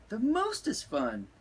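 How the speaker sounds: put-on high little girl voice; cute proud kitten; little sister voice. A narrator's reading.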